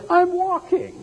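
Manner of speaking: high, thrilled cries of joy; ecstatic healed man. Acted. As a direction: crow with delight at walking again. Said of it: "He was excited".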